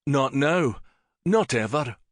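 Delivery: mild snarl. Acted. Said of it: "Syn snarled".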